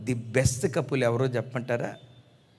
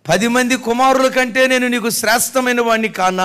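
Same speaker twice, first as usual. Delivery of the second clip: louder, higher husband's question; proclaimed quote; 9 semitones higher, 7 dB louder than usual. Retold or imitated. Preacher booming the husband's words to the congregation. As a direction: ring it out to the whole hall. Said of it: "అంటాడు".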